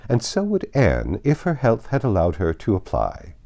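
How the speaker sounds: high, loud, overbearing great lady; domineering Lady Catherine. A narrator's reading. Lady Catherine holding forth over the drawing room, loud and high. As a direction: lord it over the whole room.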